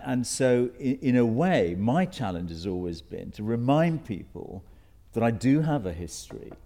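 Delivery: ordinary talk, nothing marked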